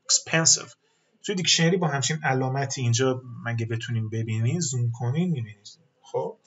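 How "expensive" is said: "Expensive" with PEN punched; stress on second syllable. Shown as a demonstration. Teacher showing students where stress falls, "Expensive" stressed on the second syllable. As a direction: hit the middle syllable of "Expensive". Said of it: "It starts with the second syllable".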